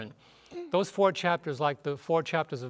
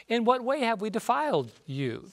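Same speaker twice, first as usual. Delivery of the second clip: indignant question; 7 semitones higher than usual. Retold or imitated. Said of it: "but you say".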